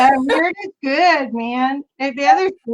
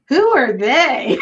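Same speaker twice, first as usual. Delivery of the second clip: slow, drawn-out puzzled question; baffled, slowed-right-down query; incredulous question; 2 semitones higher, slower than usual. Retold or imitated. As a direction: stretch it out, swooping on the first word. Retold quote of puzzled man.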